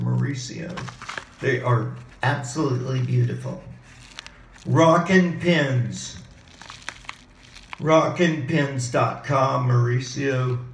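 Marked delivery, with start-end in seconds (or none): none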